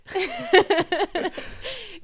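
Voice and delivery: high burst of laughter; real amused laugh. Real, not acted. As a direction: laugh out loud at being caught. Laughing after being insulted.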